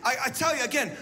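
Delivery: usual speaking voice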